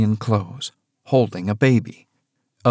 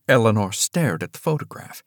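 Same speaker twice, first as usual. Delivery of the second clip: usual speaking voice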